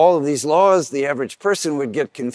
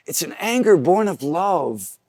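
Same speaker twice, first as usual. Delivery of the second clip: ordinary talk, nothing marked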